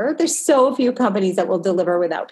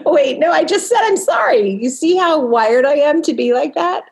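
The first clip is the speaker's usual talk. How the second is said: louder, higher laughing at herself; amused disbelief; 7 semitones higher, 5 dB louder than usual. Real, not acted. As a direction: caught herself, excited and loud. Catches herself apologizing.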